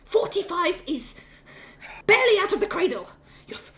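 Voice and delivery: high, emphatic telling-off; scolding mother. Acted. Mother scolding her forty-five-year-old son, emphatic telling-off. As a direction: put the grown child firmly in place.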